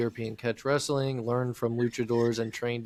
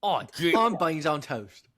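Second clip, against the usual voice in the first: higher, broad put-on British; mock British accent; 5 semitones higher than usual. Host naming British food, hammed-up British accent. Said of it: "what do they eat?".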